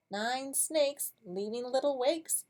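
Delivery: ordinary delivery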